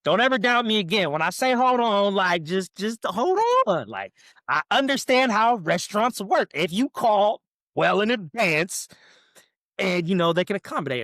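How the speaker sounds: mock triumphant lecture; gloating. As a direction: rub it in to the wife. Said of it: "and I was like".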